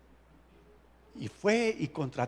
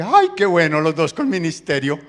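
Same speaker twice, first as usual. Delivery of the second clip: hammed-up delighted wife; gushing delight; 9 dB louder than usual. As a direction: gush with glee about shared ministry. Jezabel voiced, delighted.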